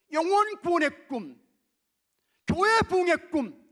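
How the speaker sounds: high, loud preacher's cry; rousing proclamation. Real, not acted. Preacher names the church's dreams to the congregation, rousing proclamation. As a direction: call it out high and forceful.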